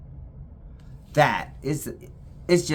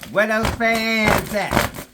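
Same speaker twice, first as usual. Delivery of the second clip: high, slow, loud puppy talk; drawn-out pet voice; 12 semitones higher, 8 dB louder, much slower than usual. Real, not acted. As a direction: call out playfully to the dog. Teasing the puppy.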